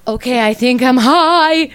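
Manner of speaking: slow, high, loud realization; dawning alarm. Retold or imitated. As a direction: draw it out loudly as it dawns on you. Acting out realizing she is high.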